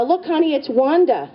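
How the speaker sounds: high, excited call to husband; mock-excited presentation; playful showing-off. Shows husband the doll.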